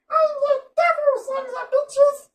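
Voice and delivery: very high, slow tiny threat; squeaky tiny devil. Acted. Tiny creature declaring it is the devil in a squeak.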